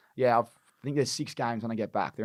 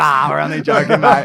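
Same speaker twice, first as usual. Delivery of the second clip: loud, high, laughing "only joking"; laughing reveal; 4 semitones higher, 15 dB louder than usual. Retold or imitated. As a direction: let them off the hook while cracking up. Prank reveal to the waiter.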